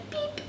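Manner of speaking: tiny, very high, soft peep; chick peep. A narrator's reading. Chick says hello after its mother, tiny soft peep. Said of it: "her chick said".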